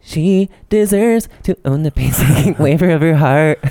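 slow, affected indie-singer voice; exaggerated slow singer impression. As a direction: draw the words out like a mannered pop singer. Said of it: "try and do a [name] voice".

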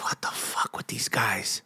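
hushed whisper, imitating a fed-up coach; whispered exasperation. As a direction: breathe it out under your breath. Imagined muttering of coach.

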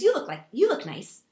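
put-on high, loud childlike sincerity; imitating an earnest little girl; little girl's compliment. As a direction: blurt it out like a small child. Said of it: "She goes".

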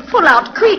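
high, loud frightened command; panicked order. Acted. Countess urges Smokey to flee the unseen voice, panicked and loud. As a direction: urge him off in terror.

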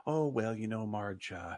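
imitating a reluctant, subdued admission; awkwardly sad. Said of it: "it says it in a way that you're like".

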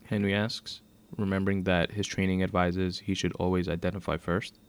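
ordinary delivery, nothing marked

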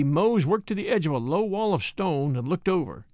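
usual speaking voice

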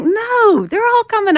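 high, loud mock protest; insistent refusal. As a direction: refuse loudly, acting out the argument. Retold refusal in an argument.

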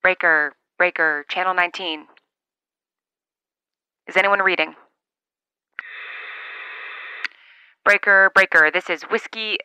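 loud radio call-out; over cb radio. Acted. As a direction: call it out into the CB mic.

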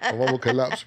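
usual speaking voice

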